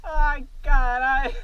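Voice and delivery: slow pained groan; crying out after a fall. Real, not acted. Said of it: "Deu dor". (gemendo de dor)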